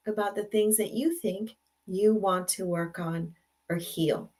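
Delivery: ordinary delivery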